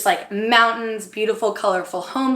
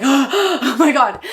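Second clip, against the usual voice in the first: breathless gasp; 3 semitones higher, 4 dB louder, much slower than usual. Retold or imitated. Gasp at seeing the proposal.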